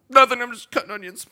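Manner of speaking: loud, high, hammed-up crying; comic onion-cutting wail, fast and high; mock crying. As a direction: blurt it through exaggerated fake tears. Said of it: "There's that cry".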